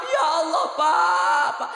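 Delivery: loud, sobbing wail of a mother; mother cries out to her husband in tears; sobbing cry. Retold or imitated. Mother overcome, wailing to her husband. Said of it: "Nangis, Bunda, nangis".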